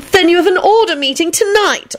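very high, whiny wail; high-pitched plaintive complaint; pouting wail. A narrator's reading. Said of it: "Elizabeth wailed and pouted".

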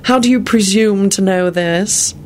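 high, slow sardonic villain; drawn-out sardonic challenge. A narrator's reading. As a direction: question him with mocking disbelief. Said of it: "Malfoy responded sardonically".

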